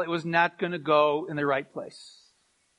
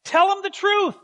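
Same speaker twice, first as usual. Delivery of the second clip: higher, gentle imitation of his wife; mimicking his wife; 10 semitones higher, 4 dB louder than usual. Pastor quoting his loving wife urging honesty, higher imitation. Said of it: "in her loving, dearing way".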